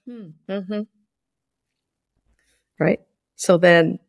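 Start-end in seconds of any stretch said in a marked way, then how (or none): 0.1-0.8 soft, absent-minded hum; barely looking up from the paper; a husband half-listening behind the newspaper; distracted grunt. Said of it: "he says".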